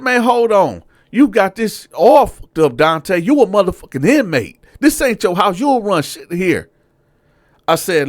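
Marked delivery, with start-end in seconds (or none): 0.0-6.7 high, loud scolding of an inmate; sergeant yelling. Retold or imitated. Sergeant telling the inmate this ain't his house, loud and high. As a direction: lay down the law, loudly. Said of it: "he said".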